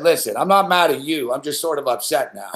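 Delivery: normal talking voice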